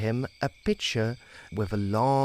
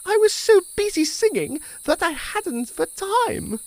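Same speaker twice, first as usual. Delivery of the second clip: grasshopper excuse; 8 dB louder than usual. Acted.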